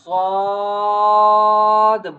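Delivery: "swad" held long and loud; bold and heavy. Shown as a demonstration. Teacher after explaining tongue on sides, bold heavy "swad". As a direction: stretch "swad" into one full, sustained note. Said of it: "bold and heavy".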